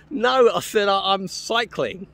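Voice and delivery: high, loud mock-exasperated protest; exasperated refusal. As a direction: insist loudly, drawing out the last words. Retold refusal to take the train.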